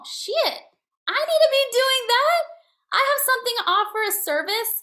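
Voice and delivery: suddenly high and loud, half crying out; excited realization. Real, not acted. Telling her audience she realized she must sell. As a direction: burst out with the realization.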